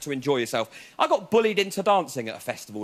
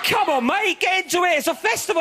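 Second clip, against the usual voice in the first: cheery raver; 12 semitones higher, 3 dB louder than usual. Retold or imitated. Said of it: "He went".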